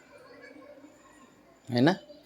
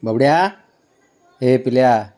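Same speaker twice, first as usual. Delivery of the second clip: ordinary talk, nothing marked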